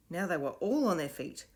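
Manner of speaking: ordinary delivery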